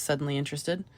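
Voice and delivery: ordinary delivery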